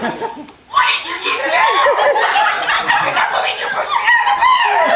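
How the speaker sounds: very high, loud mock screech; slow, drawn-out shriek of mock outrage; outraged shout. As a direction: yell in disbelief, half laughing. Acted outrage at being pushed out.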